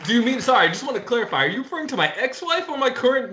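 normal talking voice

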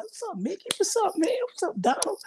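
hammed-up hello to the cartoon characters; goofy greeting. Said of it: "I'm gonna do, like".

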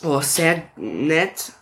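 slow, put-on German accent on "sehr nett"; German phrase. Shown as a demonstration. Answering a German fan, slow mock German accent. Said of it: "I'm from Germany".

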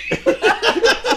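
high, loud burst of real laughter; cracking up at his own story; shrieking laugh. Real, not acted. Friends telling bar stories, real laughter.

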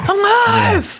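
very high screamed movie line; imitated scream. Mimics the movie Alive for the co-host, high-pitched scream. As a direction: scream it like a trailer. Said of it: "just screaming".

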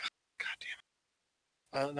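whispered, defeated groan of a curse; hushed, resigned "Oh, God damn it". Acted. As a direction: breathe it out like a beaten man. Hitchhiker resigned to punishment.

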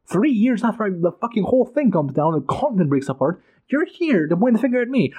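aggrieved king's outburst; ranting as Calor. Retold or imitated. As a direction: throw the blame back at them. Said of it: "And you're like".

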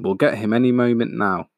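usual speaking voice

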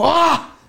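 high short grunt-yell of a big swing; mock yell as if swinging with full force; effort yell. Retelling his home-run swing, imitated effort yell. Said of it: "hasta hice un pinche sonido".

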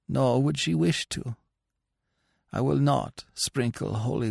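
a mild priest musing grimly aloud; old priest. A narrator's reading. Priest speaks his thoughts.

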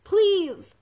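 high, loud pleading cry; desperate shout. A narrator's reading. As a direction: shout a plea with what strength is left. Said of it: "Lily shouted with what little strength".

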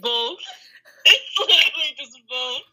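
high, amused German-accented "wolf"; "wolf" pronounced the German way. Shown as a demonstration. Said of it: "with a German accent".

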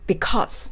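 "because" with tongue flat and no R sound; correct because, no R. Shown as a demonstration. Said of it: "このbecauseっていう音".

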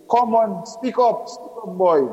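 high-pitched, impatient urging from a father; impatient prodding. A narrator's reading. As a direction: push him to speak up. Father pressing hesitant son to speak.

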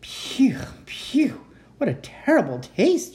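high unvoiced puffs then a disgusted cry; baby dragon choking on a tangerine. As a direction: spit the taste out, choking and high. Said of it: "choked the dragon".

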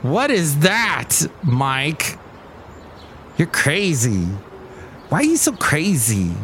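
incredulous dog's imagined voice, drawn out; Basil the Boxer. Retold or imitated. Dog staring at his podcasting owner, mock incredulous. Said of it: "he'd be staring at me like".

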